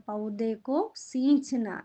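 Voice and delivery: പൗതേക്കോ സീഞ്ചന slowly, leaning on സീഞ്ചന; slow teaching read. Shown as a demonstration. Teacher to pupils, modelling the phrase slowly. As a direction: lay out പൗതേക്കോ സീഞ്ചന word by word. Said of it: "എന്താണ് പറയുന്നത്?".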